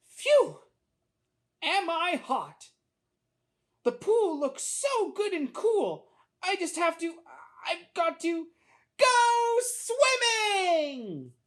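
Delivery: high voice building to a long yell; yearn, hesitate, then burst out loudly; overheated little boy. A narrator's reading. Hot boy longing for the pool, building to a cry.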